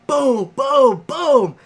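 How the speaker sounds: loud, high imitated punch sounds; mock punch booms. Imitating the guy pounding the heavy bag, loud booms. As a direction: belt out boom boom like heavy punches.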